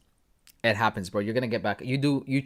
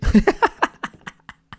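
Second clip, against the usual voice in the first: laugh; 8 dB louder than usual. Real, not acted. Laughs at own joke.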